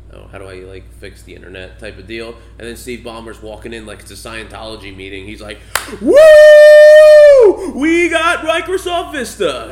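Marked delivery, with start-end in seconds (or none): 0.1-1.8 soft, lower, mild imitation of a calm nerd; a hint of a mousy tech genius, quietly puzzled; calm nerdy Bill Gates. Mocking the calm nerdy CEO to his cohost, softly. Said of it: "a very calm, nerdy". 6.0-9.7 far higher, much louder, slow hammed-up yell; screaming Steve Ballmer. Mocking the hyped CEO at a meeting, screaming. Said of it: "he's going nuts and screaming".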